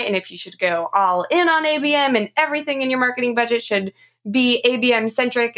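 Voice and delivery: normal talking voice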